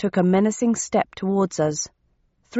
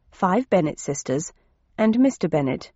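plain reading voice

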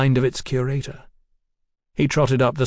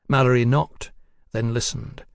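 ordinary delivery, nothing marked

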